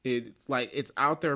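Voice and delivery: usual speaking voice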